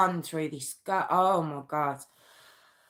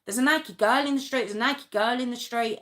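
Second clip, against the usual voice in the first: raised, quick retold shout; lightly imitating a brother shouting from the car; mild mock shout; 7 semitones higher than usual. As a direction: call it out urgently, only half acted. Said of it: "just shouting".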